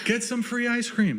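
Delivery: high-pitched vendor's cry; ice cream announcer. Acted. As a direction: sing out the offer like a shop crier. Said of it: "they're announcing the ice cream shop".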